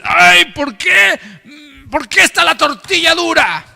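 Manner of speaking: high, whiny complaining voice; proud whiner. Retold or imitated. Preacher voicing a proud man complaining about his tortillas, high and whiny. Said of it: "Una mente orgullosa, dices".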